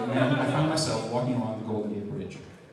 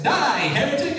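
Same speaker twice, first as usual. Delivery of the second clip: mock battle cry; 13 semitones higher, 6 dB louder, slower than usual. Pushes man off bridge.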